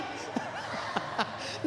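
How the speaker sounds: soft voiceless wheeze of laughter; breathless giggle between lines; laughing. Real, not acted. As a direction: chuckle quietly without voice. More laughter.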